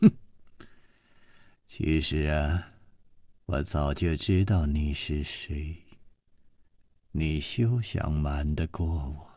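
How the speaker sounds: low, soft scornful taunt; cold sneer. Acted. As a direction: sneer that you knew all along. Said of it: "冷笑着说".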